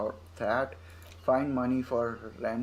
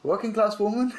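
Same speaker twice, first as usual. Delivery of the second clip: much higher and louder, rising sharply at the end; odd squeaky question; 10 semitones higher, 7 dB louder than usual. Shown as a demonstration. Streamer rereading the dialogue option in a silly high voice. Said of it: "Why did I say it like that?".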